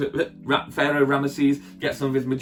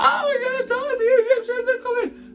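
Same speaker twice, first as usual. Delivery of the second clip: very high squealing panic; mock panic. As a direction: shriek like a hammed-up terrified crowd. Acting out panicking Israelites.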